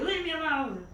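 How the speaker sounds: high, loud mock-angry yell; acted-out cross outburst; mad old man. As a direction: shoo them off like a grumpy patient. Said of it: "got really mad".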